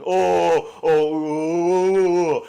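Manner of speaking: loud, high drawn-out "oh"; mocking high-pitched "ohhh" sounds; imitated barber noises. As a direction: wail long oohs in mimicry. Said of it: "he makes sounds at me".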